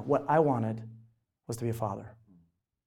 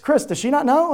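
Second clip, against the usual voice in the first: brother asking; 11 semitones higher, 7 dB louder than usual. Retold or imitated. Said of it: "and it's like".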